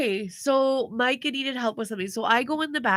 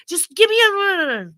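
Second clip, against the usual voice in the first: high, louder stressed retort; 9 semitones higher, 5 dB louder than usual. Retold or imitated. Husband snapping at the kids, stressed. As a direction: imitate someone getting flustered, slowly and high. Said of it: "he's getting stressed".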